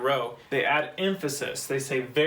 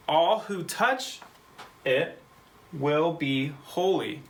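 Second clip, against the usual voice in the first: "All who touch it will be holy" read slowly, pitched up at the start; slow reading aloud; 2 semitones higher, slower than usual. Shown as a demonstration. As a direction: spell it out slowly for learners. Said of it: "We'll read it in English".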